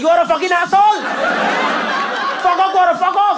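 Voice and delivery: hammed-up high outraged yell; mock angry girls. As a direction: shriek it at Gaurav. Girls in audience voiced angrily.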